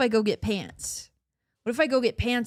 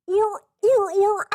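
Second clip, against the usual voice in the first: high, loud goofy "or, or"; playful high yelp; silly "or, or"; 8 semitones higher, 6 dB louder, slower than usual. Pretend. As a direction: yelp it like a running gag. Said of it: "the part where I go, "Or, or".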